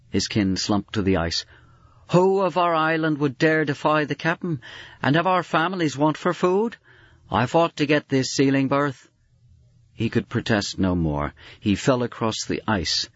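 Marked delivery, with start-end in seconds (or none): 2.1-9.1 high, strained voice of a frozen man; desperate sealer. Acted. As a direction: plead with desperation.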